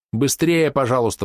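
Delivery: change tone and beg a little; pleading. A narrator's reading. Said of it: "взмолилась Мисти, переменив тон". (умоляюще, повышенным голосом)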